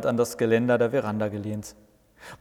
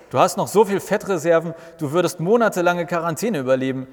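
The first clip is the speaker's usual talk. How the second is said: calling back; 5 semitones higher, 4 dB louder than usual. Acted. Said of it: "schallt es von Jesus zurück".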